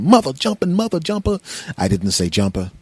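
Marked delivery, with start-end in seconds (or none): 0.0-1.4 high, censored swearing fit; comic high-pitched frustration; mock cursing. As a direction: curse in a high voice. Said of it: "and I'm going".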